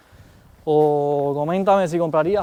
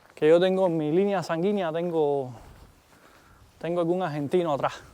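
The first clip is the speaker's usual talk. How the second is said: ordinary talk, nothing marked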